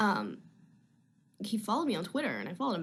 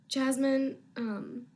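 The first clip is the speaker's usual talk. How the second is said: usual speaking voice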